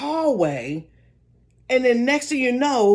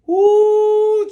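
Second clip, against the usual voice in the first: high squealing ooh; excited ooh; 10 semitones higher, 7 dB louder than usual. Retold or imitated. Reacting to scare.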